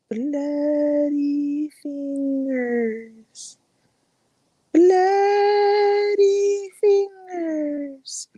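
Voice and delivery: very slow, high, drawn-out ghostly moan; eerie ghost voice. Acted. Storyteller at a campfire doing the ghost's rising moan. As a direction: stretch every syllable like a haunting. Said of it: "they hear".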